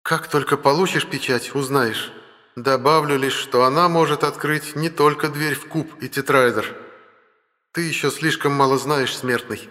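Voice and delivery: high, imperious and mocking; lofty goddess talking down to a mortal. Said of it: "властный, чуть насмешливый голос богини".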